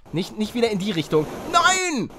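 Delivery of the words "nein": high shout of no; frustrated no. Real, not acted. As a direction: cry out at the miss. Character dying in game.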